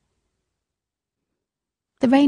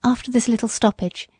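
ordinary delivery, nothing marked

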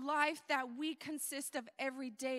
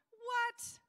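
high-pitched incredulous "what"; mock disbelief; 8 semitones higher than usual. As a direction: act taken aback by the request. Jesus imagined taken aback by request.

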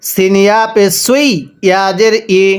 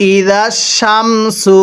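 ই জাস Shamsu drawn out slowly, each syllable held; slow teaching demo of ই জাস Shamsu; much slower than usual. Shown as a demonstration. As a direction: spell it out slowly for students. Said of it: "কিভাবে পড়তে হবে?".

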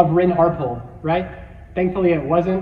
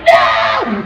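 loud, drawn-out acted "no"; hammed-up desperate shout; retold scream; 7 dB louder than usual. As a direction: yell it as if hanging off a speeding bike. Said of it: "I'm screaming at the top of my lungs".

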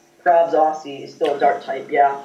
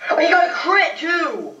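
high, drawn-out cry of disbelief; dismayed outcry; 10 semitones higher, slower than usual. Real, not acted. Player hit by a crit, high dismayed outcry. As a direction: wail it high and slow.

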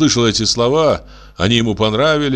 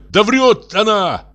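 shouting; 11 semitones higher, slower than usual. A narrator's reading. Костя shouts that his sister is lying. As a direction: shout the accusation out slowly. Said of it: "опять закричал Костя".

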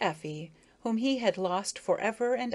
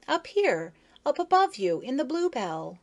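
calling out; 6 semitones higher, 3 dB louder than usual. Acted. Maya calling down from flower.

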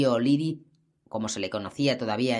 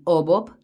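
the "O" in "O Bob" punched louder and higher; stress on O; 4 semitones higher, 5 dB louder than usual. A narrator's reading. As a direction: hit the "O" of "O Bob" as a question. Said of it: "pone énfasis en la O".

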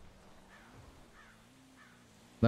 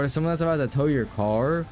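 usual speaking voice

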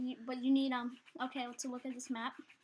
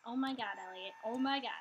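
ordinary talk

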